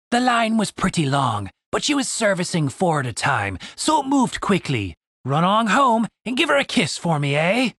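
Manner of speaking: cheeky put-on prisoner voice, rising; a leering half-elf, lightly voiced; mock-friendly jab at Julian; taunting prisoner. A narrator's reading. Prisoner taunts about mother.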